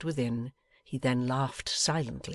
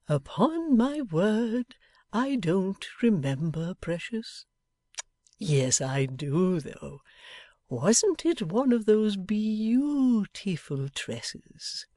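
fond father voice; 6 semitones higher, much slower than usual. A narrator's reading. Pa voiced, slow.

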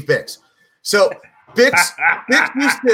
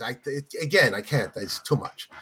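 low, hushed apologetic refusal; reluctant pope; 6 semitones lower, 7 dB quieter than usual. Acted. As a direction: turn it down softly and low. Pope declines again in joke.

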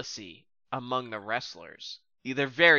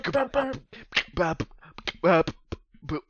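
imitated intro music; 4 semitones higher, 4 dB quieter than usual. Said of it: "It's like, boop".

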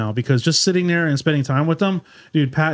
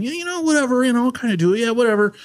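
a much higher, offhand mock Pat; Pat Gooden impression; 6 semitones higher, faster than usual. Doing his best Pat for friends, much higher and offhand. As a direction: shrug it off high and lazy, like Pat. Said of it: "I'm trying to do my best, Pat Gooden".

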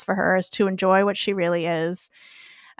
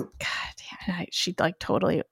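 whispering; 7 dB quieter, faster than usual. Real, not acted. Flustered after cat chaos.

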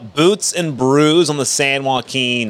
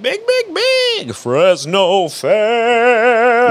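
high, drawn-out hype announcer; 8 semitones higher, much slower than usual. Pretend. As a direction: boom it like a fair ad.

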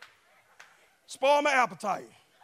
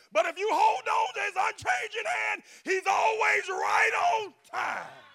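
full-force preaching; 8 semitones higher than usual. Acted.

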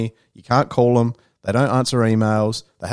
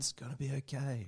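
very soft, higher comforting voice; soothing reassurance; 4 semitones higher, 18 dB quieter than usual. Acted. Customer service comforting a worried customer, soothing. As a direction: hush them gently, as if wrapping a blanket round.

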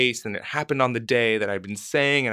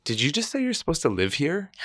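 startled question; 7 semitones higher than usual. Retold or imitated. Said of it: "And she says".

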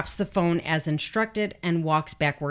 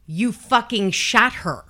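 raised, louder retold outburst; angry accusation; 4 semitones higher, 6 dB louder than usual. Boyfriend in custody to police, angry retold accusation. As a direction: imitate a distraught accusation, pitched up and loud.

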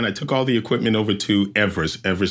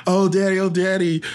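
mock kids' cry, higher; crying kids; 7 semitones higher than usual. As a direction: lightly voice the little daughters crying out. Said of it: "they're crying".

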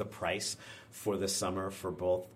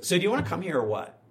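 fast, higher, louder and offhand; imitating a brusque director, quick and loud; blunt offer; 8 semitones higher, 7 dB louder, faster than usual. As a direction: rattle it off like it's no big deal. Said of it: "this is how he says this to me".

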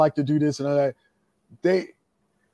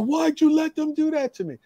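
high, whiny imitation of a crying young wrestler; hammed-up tearful complaint; mock whiny yell; 13 semitones higher than usual. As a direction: mimic the upset kid, pitched way up. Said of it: "he's crying, he's screaming at me".

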